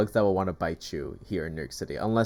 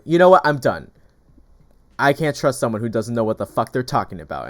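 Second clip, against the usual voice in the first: retold angry outburst; 8 dB louder than usual. Angry tenant blowing up at the broker's rep before leaving. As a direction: blow up loudly, then settle into contempt. Man storms off yelling.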